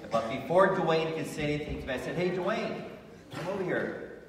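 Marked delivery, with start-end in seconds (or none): 2.1-4.2 higher-pitched friendly call-over. Retold or imitated. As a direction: act out hailing someone to come closer. Calling the bully over.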